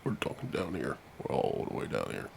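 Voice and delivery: very low, soft put-on voice; mock deep-voiced murmur; talking way down low. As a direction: sink as low and quiet as you can. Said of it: "I never talked lower in my life".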